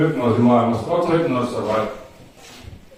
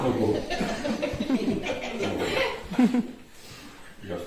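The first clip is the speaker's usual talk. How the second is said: hushed sleep command; 6 semitones higher, 15 dB quieter than usual. Acted. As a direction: put them to sleep quietly. Hypnotist orders sleep.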